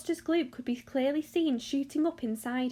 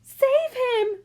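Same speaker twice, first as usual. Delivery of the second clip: high, loud cry for rescue; panicked scream; 13 semitones higher, 8 dB louder than usual. A narrator's reading. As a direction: scream for someone to save your son. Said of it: "screamed Missus Gloop".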